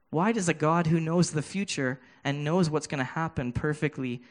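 ordinary talk

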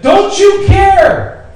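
long, high, loud cry of don't you care; drawn-out pleading shout; anguished cry. Retold or imitated. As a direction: cry it out like desperate disciples. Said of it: "when the disciples said".

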